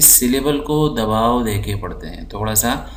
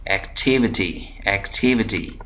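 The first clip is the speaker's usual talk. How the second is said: slow activity with टि drawn out; stress on TI. Shown as a demonstration. Teacher showing the stressed part, slow टि in activity. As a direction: stretch and press the second syllable टि of activity. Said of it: "वो ये वाला हिस्सा है".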